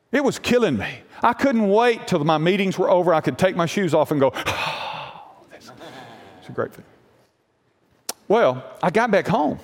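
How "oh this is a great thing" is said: soft sigh then hushed, slow relief; relieved sigh. Retold or imitated. Preacher pulling off shoes after meetings, soft relieved sigh. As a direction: breathe out the ache as the shoes come off.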